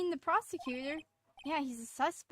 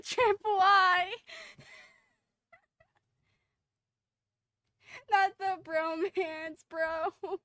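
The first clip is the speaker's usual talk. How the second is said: slow, louder, drawn-out fake lament; milder mock wail; 5 semitones higher, 8 dB louder, much slower than usual. Streamer lamenting the bromance to chat, milder slow mock wail. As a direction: stretch it out, mock heartbroken. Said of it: "I'm gonna cry".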